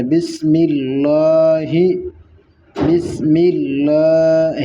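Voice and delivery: slow بسم اللہ with the لام in اللہ full and heavy; heavy lam. Shown as a demonstration. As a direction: recite بسم اللہ slowly, thickening the ل of اللہ. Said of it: "پر کہا جاتا ہے موٹے کو". (اللہ کا لام پر یعنی موٹا)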